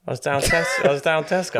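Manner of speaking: teasing Londoner; mock London voice; London accent. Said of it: "It sounded London".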